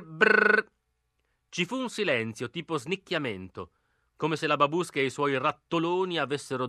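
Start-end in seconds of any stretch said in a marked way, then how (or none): 0.0-0.7 high buzzing doorbell sound. A narrator's reading. Bell rings inside the old house, high buzz. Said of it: "si sentì fare brrr".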